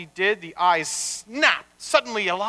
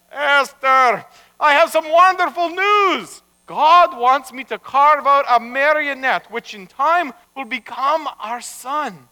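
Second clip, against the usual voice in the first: higher, loud good-news voice; proud announcement; 6 semitones higher, 6 dB louder than usual. Acted. Rabbi telling his wife wonderful news, proudly announcing. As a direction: announce it loudly, pitched up.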